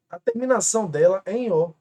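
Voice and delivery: ordinary talk, nothing marked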